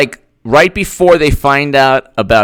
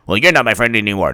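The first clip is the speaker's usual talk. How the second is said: quick, low, softer comeback; lower retort; 5 semitones lower, 6 dB quieter, much faster than usual. Acted. Other friend answers, quick low mock huff. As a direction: answer back in a lower, quieter voice.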